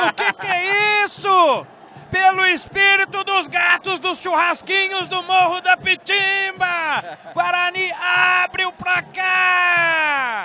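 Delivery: shouted, stretched goal celebration; explosive goal commentary. Real, not acted. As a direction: roar it out, long and high. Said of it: "Desentalei o grito de gol".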